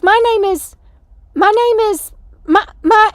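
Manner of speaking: high, loud halting introduction; imitated loud stumbling. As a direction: call it out and get stuck. Sister loudly stumbling over her name.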